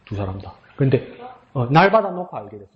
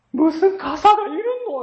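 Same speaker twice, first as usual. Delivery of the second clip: very high, slow, choked question; imitating a pastor on the verge of tears; mock tearful pastor; slower than usual. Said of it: "하면서 목사님도 울었다니까요".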